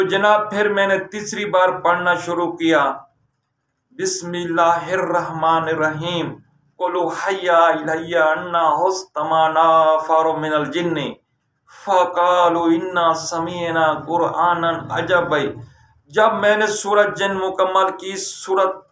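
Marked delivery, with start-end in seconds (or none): 4.0-15.5 chanted Quran verse; Quranic recitation. Shown as a demonstration. Recites verse third time.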